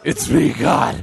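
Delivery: rough demonic growl; super scary God. Acted. God announces himself to a praying man, scary growl. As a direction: growl it like Batman playing God. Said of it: "God's just super scary".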